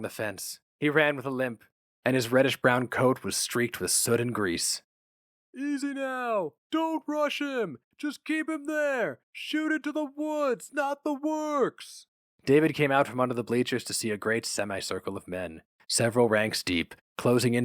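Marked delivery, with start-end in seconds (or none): 5.6-12.1 high, slow shouted orders; calling orders. A narrator's reading. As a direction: direct the men from across the field. Posse leader directs the hunt.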